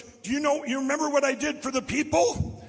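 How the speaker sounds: loud, high, eager outburst; excited exclamation, retold. Town cop at a parade reunion, imitated excited shouting.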